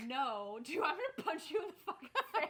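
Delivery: high, loud, laughing through a mock threat. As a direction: quote the threat in amused disbelief. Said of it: "mocking quote of the threat".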